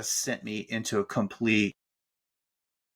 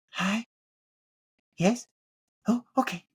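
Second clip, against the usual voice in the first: mock phone answer; 10 semitones higher than usual. Mimics therapist answering phone.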